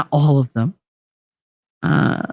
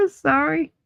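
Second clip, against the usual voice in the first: teary apology; 10 semitones higher, 4 dB quieter than usual. Real, not acted. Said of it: "Why am I crying?".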